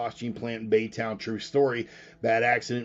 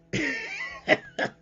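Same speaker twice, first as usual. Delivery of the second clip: high laugh. Real, not acted. Laughing after stoner bit.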